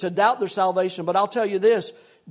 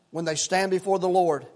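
ordinary talk, nothing marked

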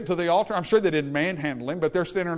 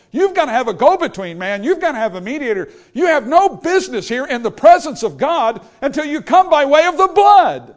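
loud preaching rebuke, building higher; rising shout; 7 semitones higher, 10 dB louder than usual. Acted. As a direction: proclaim it, louder and higher to the end. Preacher voicing gospel rebuke.

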